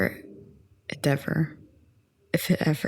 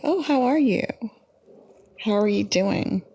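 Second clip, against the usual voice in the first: higher, bright-sounding greeting voice; imitating a friendly asker; 4 semitones higher than usual. People greeting her, imitated with a higher friendly voice. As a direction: mimic someone's chirpy hello. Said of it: "So people tell me".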